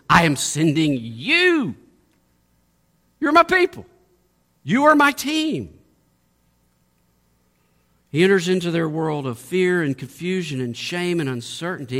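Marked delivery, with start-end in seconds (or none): 0.1-5.8 loud, high, emphatic declaration; resolute commissioning. Pretend. Jesus tells his frightened disciples they are his team, ringing out loud. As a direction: proclaim it like a leader claiming his team. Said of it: "looks straight into the faces ... and says".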